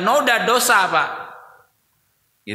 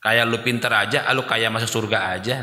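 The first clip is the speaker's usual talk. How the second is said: lower, sneering comeback; mock scornful retort; 7 semitones lower than usual. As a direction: brush off the advice with scorn. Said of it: "Nah gitu". (balasan mengejek, nada rendah)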